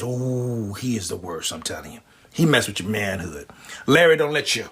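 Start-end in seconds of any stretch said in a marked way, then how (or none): 0.0-3.5 much lower and softer, confiding; low, fading confidential murmur; gossipy hush. Acted. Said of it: "he goes".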